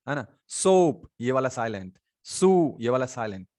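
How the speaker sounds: ordinary delivery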